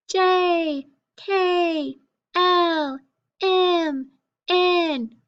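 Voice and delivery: high-pitched letter names. Shown as a demonstration. Teacher reciting consonants for pupils, high voice. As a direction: chant each consonant high, sliding down. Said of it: "in a high voice".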